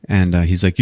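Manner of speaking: normal talking voice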